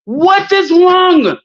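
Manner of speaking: slow, high, stretched-out "What is wrong?"; drawn-out outrage. Real, not acted. Challenging him over Somali women, drawn out and high. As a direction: stretch every word in disbelief.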